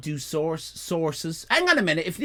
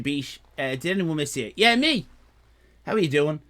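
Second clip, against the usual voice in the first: ordinary delivery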